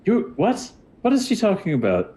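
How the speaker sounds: high, puzzled wizard voice; suspicious lizard wizard. Acted. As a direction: ask a bystander in high disbelief. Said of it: "he looks suspiciously at you".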